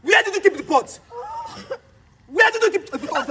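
husband shouting at his wife for the pot; furious shout. Acted. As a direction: yell it at her, demanding an answer.

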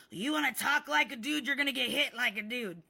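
high, loud imitation of an angry man; retold threat. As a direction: bluster as he threatens. Acted threat in quarrel.

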